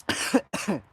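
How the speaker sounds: coughing up water. Real, not acted. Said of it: "that water went down the wrong pipe".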